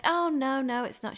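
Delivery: mock modest denial. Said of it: "She wouldn't be like".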